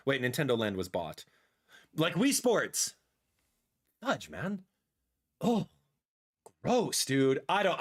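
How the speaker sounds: usual speaking voice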